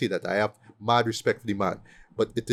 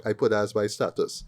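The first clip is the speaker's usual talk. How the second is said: ordinary delivery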